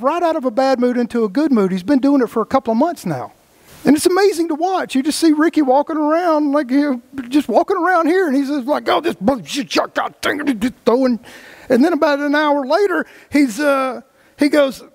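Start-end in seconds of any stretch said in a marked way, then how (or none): 3.8-13.0 animated, higher storytelling; excited, higher. Real, not acted.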